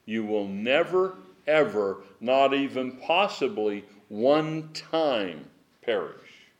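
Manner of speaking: slow, weighty stress on "never ever" and "one time"; strong emphasis. Shown as a demonstration. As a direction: spell out "not even possibly one time" slowly and firmly. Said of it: "that is so strong".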